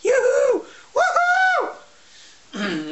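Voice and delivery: very high, loud cheering yells; high excited yoo-hoo and woohoo shouts; joyful whoop. Shown as a demonstration. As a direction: whoop like you're on top of the world. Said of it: "What does it feel like to be on top of the world?".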